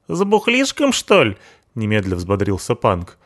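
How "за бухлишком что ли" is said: hoog, rauw punkersstemmetje, spottend; punk sidekick voice. Acted. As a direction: speel de brutale punker, veel hoger.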